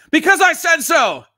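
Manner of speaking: high, loud scolding yell; yelling mom. Retold or imitated. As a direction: snap it at the kids like mom. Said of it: "she could be yelling at us".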